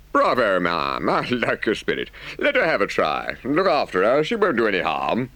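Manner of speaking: lightly voiced approving gentleman; hearty gentleman. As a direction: cheer her on with mild gentlemanly gusto. Amused gentleman in the story.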